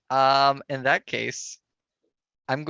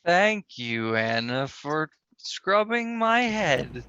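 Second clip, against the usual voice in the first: slow, drawn-out numbed speech; halting, slurred and sluggish; slightly slurred; slower than usual. Acted. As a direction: stretch the words out as if groggy. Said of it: "you may find your voice a little slurred".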